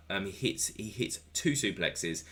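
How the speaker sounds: usual speaking voice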